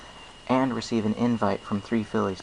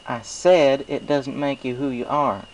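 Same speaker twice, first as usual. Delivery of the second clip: high, bright country drawl for the farm mare; folksy farm pony, friendly and sure; Applejack; 5 semitones higher, 5 dB louder, slower than usual. Acted. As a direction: play a cheerful country mare with a twang. Applejack's voice in story.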